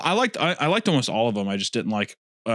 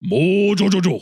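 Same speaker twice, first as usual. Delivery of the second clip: loud, slow, high villain voice; Mojo Jojo voice; 6 semitones higher, 5 dB louder, slower than usual. Pretend. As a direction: ham up the monkey villain. Imitating the cartoon villain.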